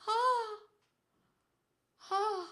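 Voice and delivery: high gasps at the icy cold; cold-shock gasps. Real, not acted. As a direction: gasp and cry out in short high bursts.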